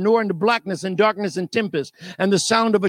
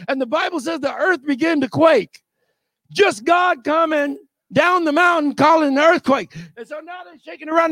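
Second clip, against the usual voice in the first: ordinary talk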